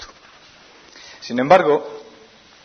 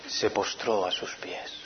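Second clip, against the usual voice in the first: slow unvoiced whisper; 7 semitones lower, 13 dB quieter, slower than usual. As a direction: let it sink, whispered slowly. Lectura bíblica con efecto dramático. (susurro lento y reverente)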